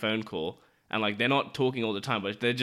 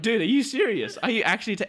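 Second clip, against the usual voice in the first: incredulous outburst; 10 semitones higher, 3 dB louder than usual. Retold or imitated. Disbelief at the pissing neighbour.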